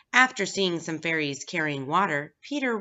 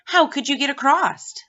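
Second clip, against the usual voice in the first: put-on much higher and louder, sneering at the boy; cruel taunt; 8 semitones higher, 8 dB louder than usual. A narrator's reading. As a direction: scoff at him, loud and high.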